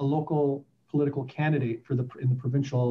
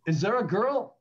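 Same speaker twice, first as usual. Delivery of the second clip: high-pitched mock maternal probing; suspicious mother; 7 semitones higher than usual. Mother questioning her son's career choice, suspicious. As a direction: imitate Mom prying, lifting into the question. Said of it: "my mother goes".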